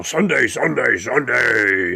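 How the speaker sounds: a hint of a booming radio promo voice; mock radio ad voice; radio announcer persona. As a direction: ham up a monster truck ad. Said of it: "radio people who put on a persona".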